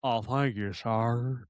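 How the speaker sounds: low, soft put-on cowboy voice; mock cowboy courtesy, low and soft; imitated cowboy. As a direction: drop low and quiet as a cowboy poser. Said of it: "he goes".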